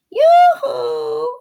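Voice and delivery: high, loud sing-song yoo-hoo; shouted call. Acted. Witch calling across the meadow to the cow, loud sing-song. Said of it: "she shouted".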